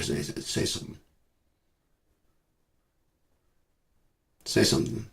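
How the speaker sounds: hushed voiceless taunt; whispered dare. Acted. Vlogger taunting the man behind the camera, whispered.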